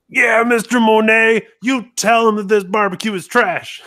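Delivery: high, loud wrestling call-out; big hyped-up trash-talk yell; acted heckling shout. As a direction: holler it across the arena in character. Acts running in to heckle wrestler.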